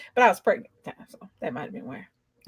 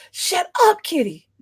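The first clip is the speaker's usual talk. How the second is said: scolding the cat; 8 semitones higher, 4 dB louder, slower than usual. Real, not acted.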